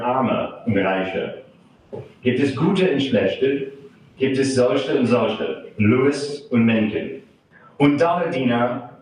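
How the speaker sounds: put-on German verse recited with an American accent; poem reading. Translator reads Tucholsky's German satire to an audience, measured poem reading. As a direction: recite in measured phrases, pausing between lines.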